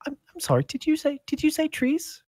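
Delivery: put-on very high, tiny girlish voice; small timid voice. Serving girl interrupting the adventurers, small high timid voice. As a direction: squeak it out shyly from the back. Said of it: "a small, quiet voice in the back go".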